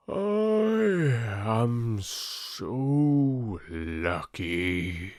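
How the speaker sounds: slow, drawn-out boastful oak tree voice; a smug giant tree bragging to the reeds; proud old oak. Acted. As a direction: let the mighty oak savour its pride. Oak tree speaks in story.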